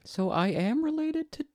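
put-on high little-boy voice; young boy voice. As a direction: ask it like a small kid. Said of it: "you're Chad as a young boy".

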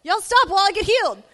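high, loud yell to the group; imitated yell. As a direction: call out excitedly, imitating a woman. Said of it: "She yells at her group".

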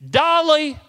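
high, loud call across the yard; screaming a name. Retold or imitated. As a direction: yell the dog's name out to the barn. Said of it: "screams out the name".

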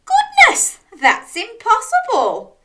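very high, loud disbelief; astonished cry. A narrator's reading. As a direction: cry out as a shocked storybook dog. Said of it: "cried Dog".

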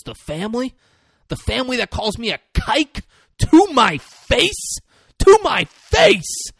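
high, loud indignant rant; imitating an affronted mobster; acted outrage. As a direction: build from incredulous question to loud high cry. Acts out mobster's outraged quarrel.

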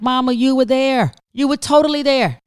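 a frustrated caregiver raising her voice a tad. Retold or imitated. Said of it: "I raised my voice a tad".

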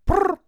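high loud trilled ring; phone ring noise. Shown as a demonstration. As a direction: buzz like an old phone. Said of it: "Oh, fine".

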